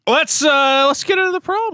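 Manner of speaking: high hyped announcer call. Pretend. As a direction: announce it like a show host. Announcing the segment.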